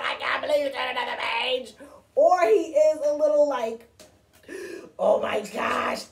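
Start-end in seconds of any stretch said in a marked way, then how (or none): 0.0-1.7 fast, rushed, excited exclamation; excited disbelief. Shown as a demonstration. Showing children how Grover might feel, excited. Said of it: "either he's really excited about the fact". 5.0-6.1 slow, exaggerated exasperation; mock angry. Shown as a demonstration. Showing children Grover might be angry, exasperated. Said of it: "angry, like".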